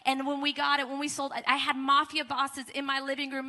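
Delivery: ordinary talk, nothing marked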